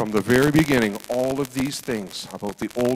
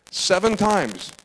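ordinary delivery

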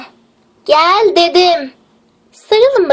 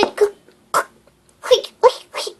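choked, stuck gasps; strained stammer; 6 dB quieter than usual. Acted. Paralysed girl gasps and cannot speak, choking. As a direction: struggle for air, words stuck.

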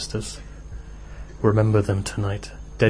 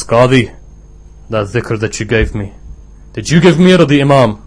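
loud, rude, challenging demand; a disciple rudely confronts his teacher; rude challenge; 3 semitones higher, 8 dB louder than usual. Acted. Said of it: "he sees that this guy is being rude".

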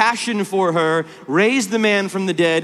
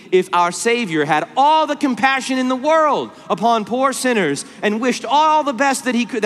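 rising, ringing pulpit voice; fervent preaching; 4 semitones higher than usual. Acted. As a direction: build to a passionate plea. Preaching at full force.

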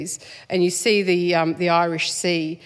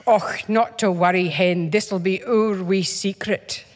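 put-on gentle, slow thick Scottish accent, lightly done; Scottish grandmother; slower than usual. A hint of a Scottish grandmother's accent. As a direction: soothe like a Scottish granny. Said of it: "had a thick Scottish accent".

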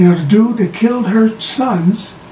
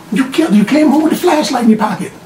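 fast, higher mock accusing voice; acted accusation; 6 semitones higher, faster than usual. Accuser presses the denying Penguin with proof, accusing. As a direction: rattle out the charge, pitched up.

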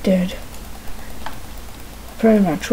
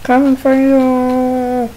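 slow, drawn-out higher threat; menacing slow voice; 3 semitones higher, 3 dB louder, slower than usual. Acted. Player menacing the squid from the boat. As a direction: creep toward them with the words.

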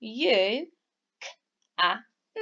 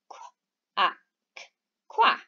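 duck quack. Pretend. Teacher imitates a duck.

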